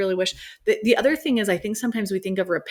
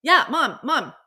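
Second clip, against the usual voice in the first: higher, breezy imitation of a kid brushing it off; kid's reply; 9 semitones higher, faster than usual. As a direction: toss it off like a carefree kid, laughing at the end. Mom voices her child's reply.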